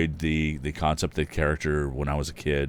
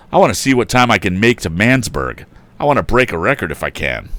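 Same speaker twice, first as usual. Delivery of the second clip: loud, animated storybook reading; story narration; 8 dB louder than usual. A narrator's reading. Narrator reading to listeners, animated and loud. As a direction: tell the tale with gusto. Reads story with character voices.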